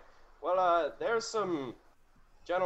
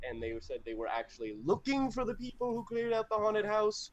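ordinary delivery

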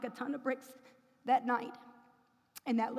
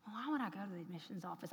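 inner thought; 6 semitones lower, 11 dB quieter, faster than usual. Retold or imitated. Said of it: "I'm just thinking".

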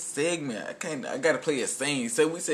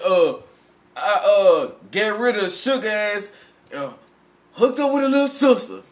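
higher, louder mock character voice; quoting a character; 6 semitones higher, 8 dB louder than usual. Friends trading movie lines, loud hammed-up quote. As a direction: belt the quote out high. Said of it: "He said".

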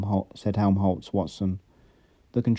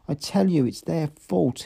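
much higher, a touch of sobbing blame; 7 semitones higher than usual. A narrator's reading. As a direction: push the blame through tears. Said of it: "he sobbed".

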